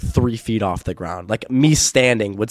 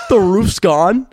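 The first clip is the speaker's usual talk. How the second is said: higher, louder startled question; mock surprise; 9 semitones higher, 6 dB louder, much slower than usual. Friend hearing the roof is gone, hammed-up surprise. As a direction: act shocked at the news.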